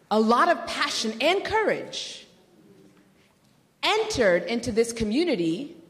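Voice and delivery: usual speaking voice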